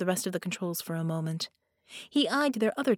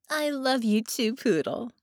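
playful, teasing and coy; flirtatious; 6 semitones higher than usual. A narrator's reading. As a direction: tease him sweetly, like a flirt. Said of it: "she said flirtatiously".

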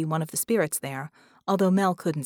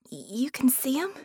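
breathless excitement; 6 semitones higher than usual. A narrator's reading. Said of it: "Penny breathed, sounding excited".

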